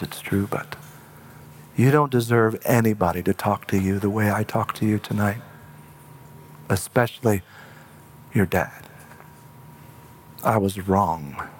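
retold apology, low and soft, with pauses; subdued apology. Father returns to tell his son he was wrong, subdued apology.

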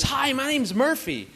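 high-pitched imitation of a loud toddler; high little-kid voice calling out; little girl's yell. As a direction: announce yourself like a proud preschooler. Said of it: "yells out as loud as she can".

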